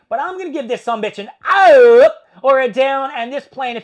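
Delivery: high, booming showman's verdict; loud announcement. Real, not acted. Vlogger announcing the rating to viewers, loud and showy. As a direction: call it out big, hitting "up" hard. Builds up his thumbs verdict, calling out.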